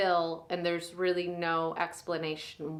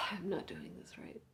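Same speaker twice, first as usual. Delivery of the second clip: whispered, softer, turning inward; a soft, ashamed whisper to yourself; hushed self-reproach; 12 dB quieter than usual. Acted. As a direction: scold yourself under your breath.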